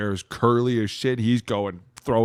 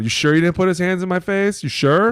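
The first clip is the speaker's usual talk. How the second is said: heated confrontation; 10 semitones higher, 8 dB louder than usual. Retold or imitated. Player confronting ref.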